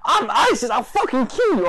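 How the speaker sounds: loud, very high furious shouting; full-blast imitation of a raving man; threatening rant. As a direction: act out an unhinged, threatening tirade. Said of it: "he starts threatening".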